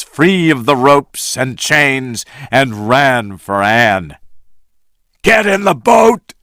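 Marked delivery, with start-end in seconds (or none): 5.2-6.3 higher urgent command; urgent shout. A narrator's reading. Jack hurrying Anne into the boat, urgent. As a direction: hurry her in. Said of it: "Jack said to Anne".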